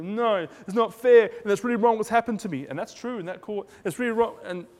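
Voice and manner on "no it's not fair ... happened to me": a hurt believer complaining to God, loud and whiny; whining protest. Retold or imitated. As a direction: whine that it's not fair. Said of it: "You go".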